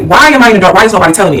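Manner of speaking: usual speaking voice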